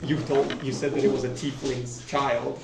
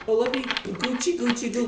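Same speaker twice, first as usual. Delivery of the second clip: put-on high-pitched baby talk; cooing at baby; 8 semitones higher than usual. Guild contact reaches for the baby, cooing. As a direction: tickle the baby with a high coo.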